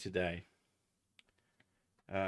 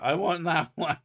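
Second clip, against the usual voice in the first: loud, higher, laughing shout; mock shout; 6 semitones higher, 8 dB louder than usual. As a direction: call it out as a joke demand. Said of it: "point at an employee in a pet shop and shout".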